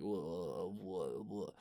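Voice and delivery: low, soft, groggy moan; drugged wooziness. Acted. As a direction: drift through it as if on painkillers. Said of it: "you're in and out, like, with the, with the painkillers".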